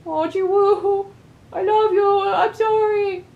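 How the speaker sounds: high, loud, slow pitying coo; sympathetic whimper. Real, not acted. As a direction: coo at him in sorrow. Player grieves for character.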